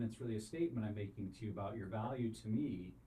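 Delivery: ordinary talk, nothing marked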